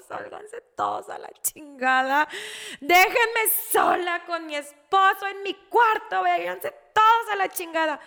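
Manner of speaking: high, loud crying demand; tearful outburst. Real, not acted. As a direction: yell at them to get out through real tears. Relives outburst while crying. (gritando entre llanto)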